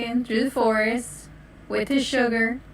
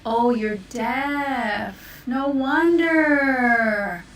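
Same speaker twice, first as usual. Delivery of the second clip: slow understanding; much slower than usual. Acted. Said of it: "I'm deaf".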